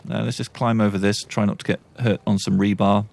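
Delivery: ordinary talk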